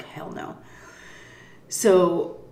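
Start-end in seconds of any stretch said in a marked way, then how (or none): 0.0-0.6 breathless whisper; whispered hell no. Retold or imitated. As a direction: whisper it as you flee. Fleeing the cops.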